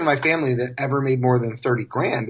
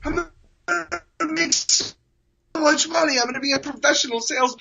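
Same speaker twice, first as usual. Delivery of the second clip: high-pitched, gushing imitation of happy crying; mock tearful excitement; 13 semitones higher than usual. New hire phoning his grandma, hammed-up teary excitement. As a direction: squeal it through pretend happy tears. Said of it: "'cause I was so excited".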